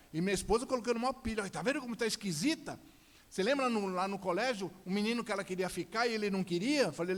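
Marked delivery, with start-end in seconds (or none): 1.4-6.9 high, agitated complaining; goading wife. Retold or imitated. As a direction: stir him up against her, voice raised.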